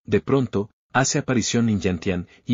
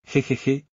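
a higher sly "jejeje"; high chuckle; 4 semitones higher than usual. Acted. Lin Yantian answering confidently, with a higher smug chuckle. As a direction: giggle smugly before the reply.

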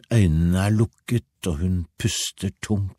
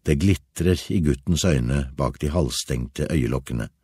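ordinary delivery

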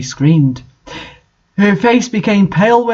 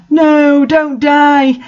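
sobbing; 7 semitones higher, 3 dB louder, much slower than usual. A narrator's reading. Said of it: "Satan sobbed".

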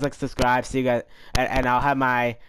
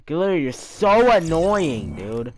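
angry rant; 6 semitones higher, 6 dB louder than usual. Real, not acted. Angry after losing challenge.

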